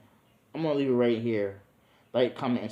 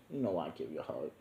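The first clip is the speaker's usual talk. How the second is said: low, soft and quick flirty reply; teasing low flirt; 6 semitones lower, 9 dB quieter, faster than usual. Retold or imitated. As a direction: purr it low to her. Flirting with Nami.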